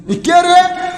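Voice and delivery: high-pitched mock crowd shout; imitated outcry. Everyone in the mosque crying who is it, high imitated shout. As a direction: call it out high, like an indignant crowd. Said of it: "কে রে করে চিৎকার উঠছে".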